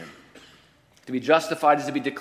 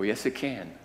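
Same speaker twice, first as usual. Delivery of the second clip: ordinary delivery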